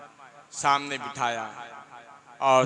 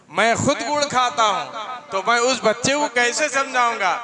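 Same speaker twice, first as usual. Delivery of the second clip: higher, louder sermon voice driving the point home; forceful preaching; 8 semitones higher, 6 dB louder than usual. Acted. Preacher voicing the saint's answer to the mother, in loud, high preaching. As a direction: proclaim it to the crowd.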